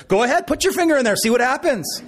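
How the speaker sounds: dad daring his son to touch the socket, playful and quick; mock dare. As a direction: dare him, half laughing. Reverse psychology on son.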